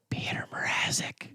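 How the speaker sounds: hushed unvoiced whisper of the name. Teasing the cohost with a whispered name. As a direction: breathe the name like a secret.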